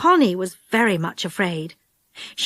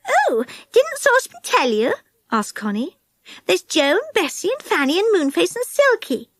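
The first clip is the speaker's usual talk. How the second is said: put-on higher, eager young girl; Connie's bright girl voice; 7 semitones higher than usual. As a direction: speak up high like a keen little girl. Connie explains.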